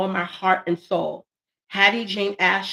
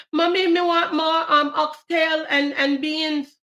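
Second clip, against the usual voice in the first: put-on childish begging voice; 7 semitones higher than usual. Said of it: "Mommy, make me some more".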